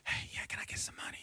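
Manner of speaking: soft unvoiced whisper; hushed whisper to the teller. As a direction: whisper the request discreetly. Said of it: "some lady just whispered".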